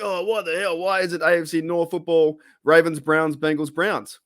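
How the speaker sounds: mildly hammed-up fan complaint; mock exasperation. Mimics upset fans complaining.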